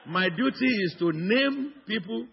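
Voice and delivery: ordinary talk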